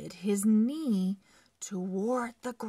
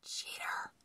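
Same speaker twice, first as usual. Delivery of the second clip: soft, unvoiced hissed insult; whisper; 10 dB quieter than usual. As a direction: whisper it spitefully. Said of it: "and whispered".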